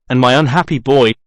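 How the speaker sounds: usual speaking voice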